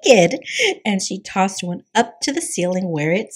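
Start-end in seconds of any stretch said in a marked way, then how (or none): 0.0-0.4 high, loud, gleeful squeal; giggling. A narrator's reading. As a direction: squeal it like a giggling little girl.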